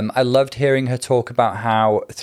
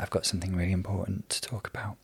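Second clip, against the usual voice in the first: whispered; 3 semitones lower, 12 dB quieter, faster than usual. Retold or imitated. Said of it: "when Ginger's like".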